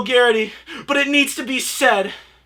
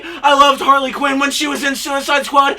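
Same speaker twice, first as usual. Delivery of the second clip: ordinary delivery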